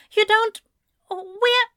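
high, loud disbelief; stunned. Acted. Ezra stunned by Anthony's words, high and loud. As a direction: break off in shock.

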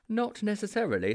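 a hint of a self-appointed detective; Mildew's explaining voice. A narrator's reading. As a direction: explain patiently as the expert friend.

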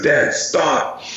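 a hint of a protesting child; imitated upset child. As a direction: beg Dad like a small boy, lightly acted. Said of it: "And he goes".

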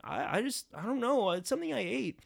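faking sick. Pretend. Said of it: "trying to act it up".

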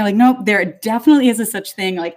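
normal talking voice

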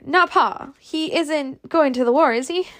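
high-pitched, anxious exclamation into question; terrified. A narrator's reading. Scarlet asks if her father is going, in terror. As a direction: plead to be told it isn't true. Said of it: "cried Scarlet in terror".

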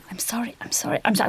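whispered sorry, sorry, sorry; mock whispered apology. Leaving the theatre whispering apologies to the audience. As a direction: slip past murmuring apologies. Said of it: "not even like".

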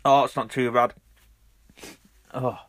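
ordinary talk

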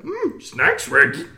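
high-pitched cartoon dog impression; Scooby-Doo. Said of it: "If I was Scooby-Doo, I'd be like".

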